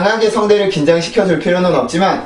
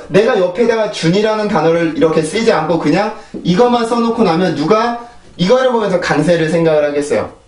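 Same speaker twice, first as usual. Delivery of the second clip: normal talking voice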